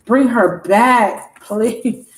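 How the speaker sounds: drawn-out acted plea to God; pleading prayer. Voicing a man praying for her return, slow pleading. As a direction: beg slowly, leaning long on please. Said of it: "He's been saying".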